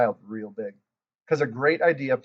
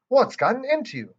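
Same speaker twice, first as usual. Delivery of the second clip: shocked grandma; 11 semitones higher, 5 dB louder than usual. Acted. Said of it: "very shocked".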